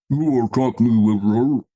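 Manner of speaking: loud, distorted cartoon robot villain. Host imitating a cartoon villain for the co-host, robot voice. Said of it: "sort of that kind of robot voice".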